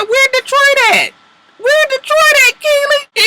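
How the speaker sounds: high, loud, gloating call; mocking taunt. Real, not acted. Speaker mocking beaten Keely.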